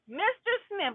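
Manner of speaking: a hint of a smug judge; sarcastic judge. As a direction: sing the name out high like a condescending judge. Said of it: "Sarcastic people".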